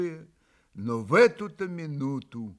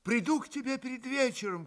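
much higher, called after him; calling out; 10 semitones higher, 4 dB quieter than usual. A narrator's reading. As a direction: call it out while leaving. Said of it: "крикнул Алёша".

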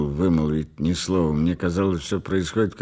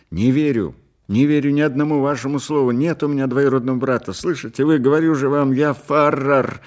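higher, fast, insistent outburst; angry denial; 6 semitones higher, 4 dB louder than usual. A narrator's reading. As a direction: protest hotly, pushing the pitch up. Patient angrily denies having a cousin.

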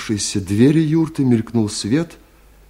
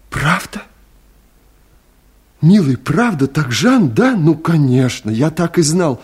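higher, eager burst of joy; overjoyed; 5 semitones higher than usual. Acted. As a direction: tumble out the words in delighted certainty. Абай hears she is really there. (радостно, взволнованно)

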